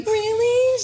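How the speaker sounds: high, delighted disbelief; surprised really. Retold or imitated. Answering the therapist, high and delighted. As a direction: lift it way up in surprise.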